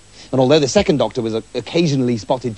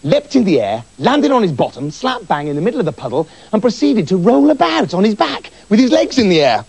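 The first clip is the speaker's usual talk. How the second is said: ordinary delivery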